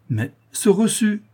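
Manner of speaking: high, slow, insistent demand; curt demand. Acted. Notary demands receipt from protesting client, impatient and insistent. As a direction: cut in impatiently, pitched well up.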